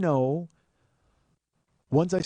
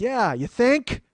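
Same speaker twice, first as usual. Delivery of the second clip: loud, higher sarcastic snap; sarcastic retort; 8 semitones higher, 7 dB louder, much slower than usual. Retold or imitated. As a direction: throw it back at the neighbors. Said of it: "And I'm like".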